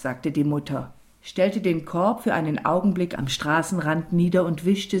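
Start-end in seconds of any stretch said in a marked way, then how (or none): none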